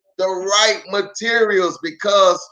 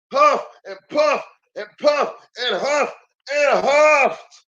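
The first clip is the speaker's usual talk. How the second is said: higher, rhythmic, strained huff puff puff; frantic huffing and puffing; 6 semitones higher than usual. A narrator's reading. Storyteller acting out the wolf puffing at the brick house. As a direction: keep puffing harder and harder.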